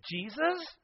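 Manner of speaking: high, whining complaint to Jesus; whiny Martha. Acted. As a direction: complain like a put-upon sister. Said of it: "She says".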